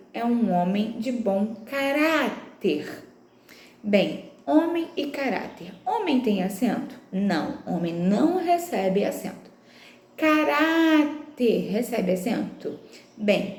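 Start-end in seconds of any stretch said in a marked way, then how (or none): 1.6-3.0 "caráter" slowed, with a long RÁ; stress on RÁ. Shown as a demonstration. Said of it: "a sílaba tônica é orar". 10.1-11.7 "Caráter" drawn out on a long RÁ; stress on RÁ. Shown as a demonstration. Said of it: "a sílaba tônica é orar".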